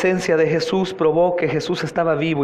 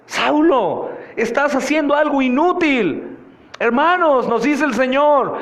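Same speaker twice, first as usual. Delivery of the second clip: high, insistent pulpit questioning; urgent, raised-pitch sermon delivery; impassioned preaching; 7 semitones higher than usual. Acted. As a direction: hammer each question at the congregation. Preaches as the Lord rebuking.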